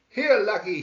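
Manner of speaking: loud, high call across the woods; shouting. A narrator's reading. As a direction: call the lost mule from far off.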